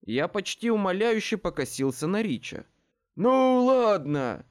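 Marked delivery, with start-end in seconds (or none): 3.2-4.5 loud, high, long-drawn give-up; exasperated sigh. A narrator's reading. Rich breaks up the sisters' quarrel, exasperated. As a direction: stretch it out, fed up. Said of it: "вздохнул Рич".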